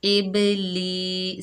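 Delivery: इब ली with the ली held long; very slow recitation of इब ली; drawn-out vowel. Shown as a demonstration. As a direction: stretch ली one alif slowly. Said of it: "एक अलिफ की मिकदार खींच कर पढ़ेंगे".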